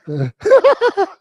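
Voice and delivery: high, loud burst of giggling; laugh. Real, not acted. Teacher unsure how students enjoy his stories, high loud laugh. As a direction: break into a high-pitched real laugh.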